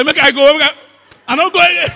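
high, loud comic threat to go; hammed-up high refusal; Nigerian Jesus. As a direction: shout it like a man storming off in mock offence. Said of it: "You now put one leg".

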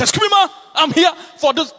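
high, loud call after her. Retold or imitated. Pastor retelling calling out to the woman, high and loud. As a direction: call out to catch her attention.

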